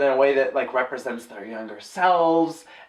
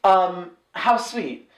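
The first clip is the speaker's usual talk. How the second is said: dry sarcasm; 2 semitones higher, much slower than usual. Pretend. Sarcastic drawn remark.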